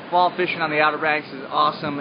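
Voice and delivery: ordinary talk, nothing marked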